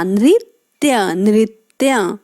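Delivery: नृत्य said slowly, syllable by syllable, न-रि-त्य; slow careful नृत्य. Shown as a demonstration. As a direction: spell out नृत्य slowly for children. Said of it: "न में री की मात्रा". (नृत्य धीरे-धीरे, साफ़ उच्चारण)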